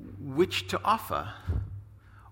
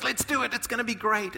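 eager; 7 semitones higher than usual. Retold or imitated. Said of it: "she goes".

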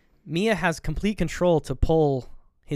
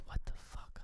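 whispered stunned mutter; under his breath; 15 dB quieter than usual. Retold or imitated. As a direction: breathe it out, barely audible. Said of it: "under his breath".

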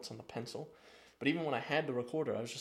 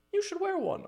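mocking high voice. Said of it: "they were like".

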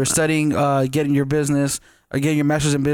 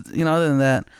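ordinary talk, nothing marked

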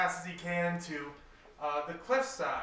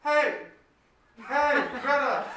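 loud, high hail with laughter creeping in; yelling down; 8 semitones higher, 9 dB louder than usual. Acted. Tang tries again to hail Greta in the boat, laughing yell. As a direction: hail a friend far below, half laughing. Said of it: "Tang yells down".

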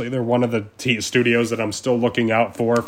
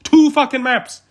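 high, loud, slow "Two fucking maps"; exasperated shout; 5 dB louder than usual. Real, not acted. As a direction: yell it in disbelief. Angry rant, repeated outburst.

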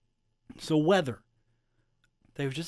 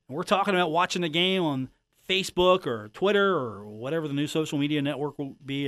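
ordinary delivery

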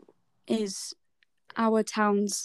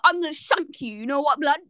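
put-on London roadman accent; 2 semitones higher than usual. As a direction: imitate a kid putting on London street talk. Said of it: "talking like they were from London".

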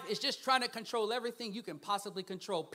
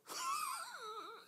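high laugh; 7 dB quieter than usual. Real, not acted. Laugh after a point.

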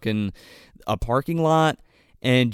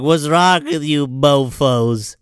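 slow, put-on drunk voice, louder; mock drunk girl; 5 dB louder, much slower than usual. Drunk girl insulting Josh, slow and slurry. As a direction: drag out mofos like a sloppy drunk.

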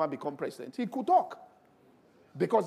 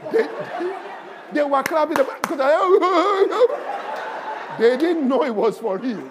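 laughing retelling; 10 semitones higher, 13 dB louder, slower than usual. Retold or imitated. Laughing at the class's reaction.

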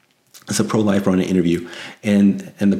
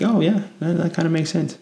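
high, airy mock voice; a high-pitched sarcastic "makes sense"; mock agreement; 9 semitones higher than usual. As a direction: act out a casual shrug of agreement. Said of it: "I'd be like".

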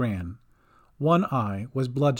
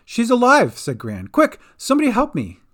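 loud, high, urgent cry; urgent excitement; 9 semitones higher, 5 dB louder than usual. A narrator's reading. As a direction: call out in a rush of alarm. Drowned girl revives.

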